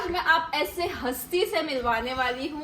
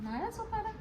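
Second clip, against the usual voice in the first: high, soft questioning echo; softly thrown-back question; incredulous echo; 8 semitones higher, 8 dB quieter than usual. Real, not acted. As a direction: echo it back much higher, puzzled. Echoes the question back in surprise.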